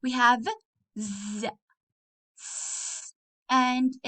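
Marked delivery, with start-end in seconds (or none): 1.0-1.5 z alone, soft and low; buzzing z sound. Shown as a demonstration. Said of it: "we have some sounds here". 2.4-3.1 s alone, soft hiss; hissing s sound. Shown as a demonstration. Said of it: "we have some sounds here".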